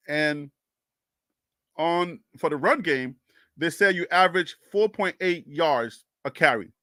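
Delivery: slow, deliberate explanation in separated chunks; saying it slowly. Real, not acted. Streamer explaining statistics to doubting viewers, slow and deliberate. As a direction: spell it out patiently, pausing between phrases. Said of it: "I'm saying it slowly".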